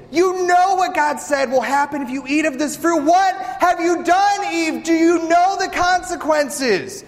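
high, distraught pleading husband; panicked quivering Adam. Acted. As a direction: cry out in alarm at his wife. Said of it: "starts to get a little quiver in his voice".

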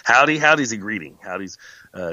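ordinary talk

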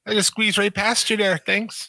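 put-on very high, over-the-top Wisconsin accent. Podcaster plays polite Wisconsinite squeezing past, exaggerated Wisconsin accent. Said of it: "This Wisconsin's way over the top".

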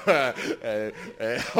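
ordinary talk, nothing marked

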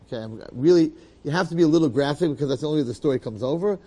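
usual speaking voice

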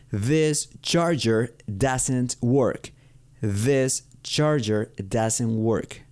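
very slow, word-by-word teaching delivery; exaggerated model pronunciation. Shown as a demonstration. Teacher giving learners an example sentence, slow exaggerated diction. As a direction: space out every word and lean on the th. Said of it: "pueden exagerar un poco para que".

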